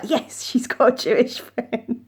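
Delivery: lightly voiced gleeful grandma, chuckling; imitating delighted grandma. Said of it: "She was like".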